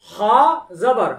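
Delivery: loud, deliberate syllable recitation; Quran recitation drill. Shown as a demonstration. Quran teacher reciting syllables for students to repeat.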